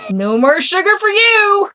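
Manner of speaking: high-pitched playful ban; mock scolding. Host banning sugar after the giggles, mock scolding. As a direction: put on a high, silly telling-off.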